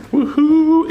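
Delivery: loud high whoop; cheer. Real, not acted. Minister whoops in his youth room, loud high cheer.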